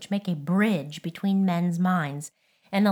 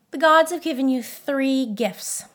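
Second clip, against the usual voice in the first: sniffing Snow Queen; 7 semitones higher, 4 dB louder than usual. Acted. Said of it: "she sniffed".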